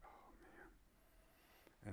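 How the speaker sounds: breathy whisper, deflated; whispered sigh. Retold or imitated. Talking about quitting fighting, a whispered oh man. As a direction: whisper it to yourself, beaten. Retold thought, defeated.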